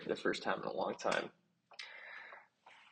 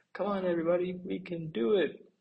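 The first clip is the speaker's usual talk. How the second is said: high, loud encouragement; rally everyone to keep going; rallying call; 12 semitones higher, 4 dB louder than usual. Acted. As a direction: bunnies calling the others to fight on. Rallying fleeing bunnies.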